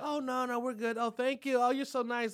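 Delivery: put-on high, sugary polite voice for the young women; sweet girls. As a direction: coo the thank-yous like sweet young women. Girls declining his drink offer.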